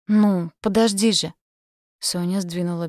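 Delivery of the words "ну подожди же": loud, slow, desperate plea; pleading protest. A narrator's reading. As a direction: beg him to stop, drawn out. Sonya protests his advances.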